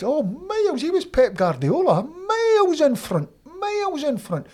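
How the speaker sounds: high, loud and slow with excitement; excited. Real, not acted. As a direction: stretch out miles in front, thrilled. Excited praise of the coach.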